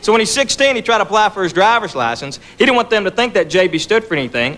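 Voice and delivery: ordinary delivery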